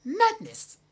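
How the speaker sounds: imitating a demanding bride, high pitched yell; bride's demand. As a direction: shout it like a spoilt bride stamping her foot. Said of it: "and went".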